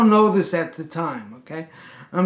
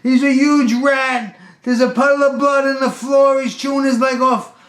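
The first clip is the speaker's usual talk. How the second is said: high, loud panicked yelling; mock-frantic, shouted report of a horror; hysterical; 9 semitones higher, 7 dB louder than usual. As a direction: imitate a hysterical customer. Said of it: "He's hysterical".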